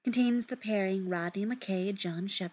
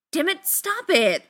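louder and higher, fed up; frustrated shout; 9 semitones higher, 6 dB louder than usual. Acted. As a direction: snap it out while struggling. Struggling to sit up.

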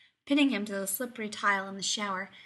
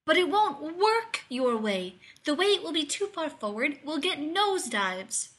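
insisting; 6 semitones higher, 4 dB louder than usual. A narrator's reading. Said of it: "Rodney insisted".

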